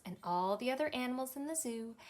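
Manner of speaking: plain reading voice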